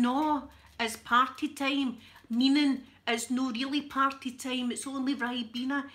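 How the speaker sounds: loud, exasperated Scottish explanation; Kevin Bridges. Retold or imitated. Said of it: "Kevin Bridges turned around and went".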